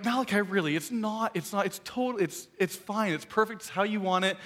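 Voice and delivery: pleading. Retold or imitated. Dad pleading with his son.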